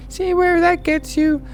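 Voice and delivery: very high falsetto warning; cutesy high threat with a titter; girl visitor. Acted. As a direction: warn in a squeaky put-on girl voice. Voicing the female visitor.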